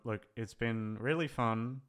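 ordinary talk, nothing marked

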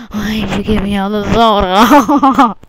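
loud mock yell; playful shout toward mom. Real, not acted. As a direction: call out to mom. Yelling to mom.

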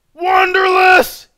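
long, high screamed greeting; huge stretched-out holler; screaming a name. Real, not acted. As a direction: scream the viewer's name in delight. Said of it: "trying not to scream out your name".

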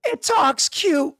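very high, slow and cooing; squeaky cooing goblin. Acted. Goblin gushing that the snake talks cute, very high and slow. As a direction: coo over the snake like it's adorable. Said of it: "freaked out goes".

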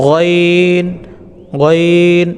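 গইন said with a throaty gh, drawn out; Arabic letter ghain. Shown as a demonstration. Teacher demonstrating গইন to students, drawn out. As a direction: demo গইন from the throat, held long. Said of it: "এভাবে গইনটি উচ্চারণ করবেন".

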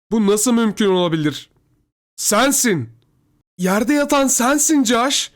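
high, loud disbelief rising to a drawn-out cry; distraught sister. A narrator's reading. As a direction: cry out in shocked horror. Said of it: "titreyerek ağlamaya başladı". (dehşet içinde, tiz sesle haykırarak)

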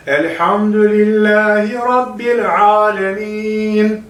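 Rabbil with a doubled b, never a p, said slowly; correct recitation. Shown as a demonstration. Teacher shows students the correct Rabbil, slow and careful. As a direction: say Rabbil with a held bb and no p sound. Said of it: "Bir daha okuyalım".

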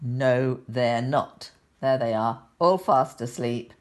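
plain reading voice

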